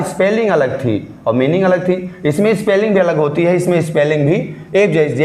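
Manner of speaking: ordinary talk, nothing marked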